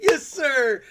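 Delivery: squeaky high little "yes sir"; high mock "yes sir". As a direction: pop it up high and meek. Mock reply to the joke.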